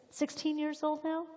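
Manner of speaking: high, rising surprised question. Real, not acted. Host marvels to Tim at the site's age, surprised. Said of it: "I mean, that's shocking".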